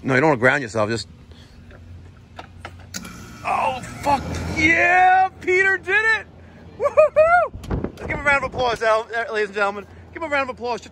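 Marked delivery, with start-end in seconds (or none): 3.4-7.5 high, loud whooping cheer; excited cheering. Real, not acted. Tech finishes the repair. 8.0-10.8 announcer calling for applause; animal show trainer. Pretend. Asks applause for Peter.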